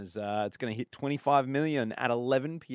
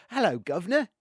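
higher-pitched British greeting; British accent; 5 semitones higher than usual. Shown as a demonstration. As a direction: demo a hammed-up British hello. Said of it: "Like, that's British".

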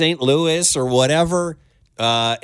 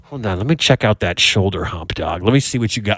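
tough guy voice; 7 semitones lower than usual. Pretend. Acting out meeting a bear.

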